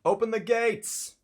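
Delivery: loud, high-pitched guard's shout; yelling guard. Acted. Guard calling for the town gates to be opened, yelling. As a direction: holler it out like a guard on duty. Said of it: "a guard yelling".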